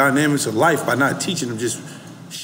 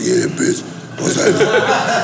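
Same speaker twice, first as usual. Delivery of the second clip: imitating his voice; 8 semitones higher, much slower than usual. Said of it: "And his voice like".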